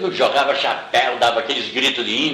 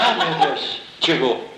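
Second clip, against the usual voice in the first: mock laughing greeting; 2 semitones higher, slower than usual. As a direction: laugh in mock dismay at the newcomer. Imitates rival laughing on seeing him. (imitando risada aguda de deboche)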